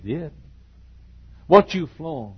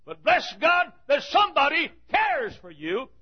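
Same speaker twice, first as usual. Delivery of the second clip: climbing higher and louder into a shout; full-force preaching; 8 semitones higher, 4 dB louder than usual. Acted. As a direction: preach it, build to the cry. Preaching climax, rising to shout.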